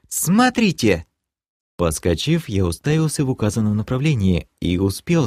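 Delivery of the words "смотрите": very high, startled call; piercing cry. A narrator's reading. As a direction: cry out sharply to point out danger. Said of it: "пронзительно вскрикнула успевшая вскочить девушка". (пронзительно вскрикнуть, высоко)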